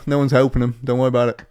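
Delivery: ordinary delivery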